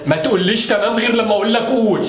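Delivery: stern scolding. Acted. Strict father scolding.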